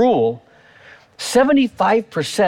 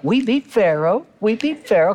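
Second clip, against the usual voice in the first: thrilled; 8 semitones higher than usual. Retold or imitated. Preacher voicing Israelites thrilled at beating Pharaoh, high cheer. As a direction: crow it like a winning team. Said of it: "'cause they're all thrilled".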